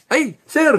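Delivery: high, surprised cry with a laugh; a fan spotting him in the crowd; delighted squeal. Retold or imitated. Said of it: "fan spotting him".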